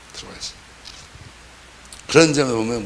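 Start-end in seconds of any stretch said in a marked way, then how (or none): 0.1-0.6 들어와 있어요 repeated near-silently, much softer; soft, barely voiced repeat of 들어와 있어요; quiet echo. Shown as a demonstration. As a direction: let 들어와 있어요 fall away to almost nothing.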